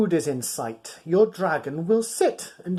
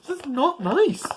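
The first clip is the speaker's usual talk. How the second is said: ordinary delivery, nothing marked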